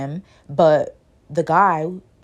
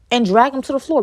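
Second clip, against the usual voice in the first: ordinary delivery, nothing marked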